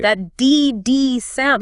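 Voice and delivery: D D said very slowly with the tongue curled back; curled tongue heavy consonants. Shown as a demonstration. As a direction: retroflex D D, slow and percussive. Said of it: "that's a retroflex consonant".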